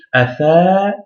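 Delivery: a tha with the a held long; drawn-out a tha. Shown as a demonstration. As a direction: stretch the a before tha. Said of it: "il faut prolonger le a".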